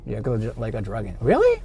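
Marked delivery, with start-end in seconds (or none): 1.2-1.6 sharp high "really?" in disbelief; surprised. Real, not acted. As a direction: pop the word up in surprise. Sudden disbelief at location.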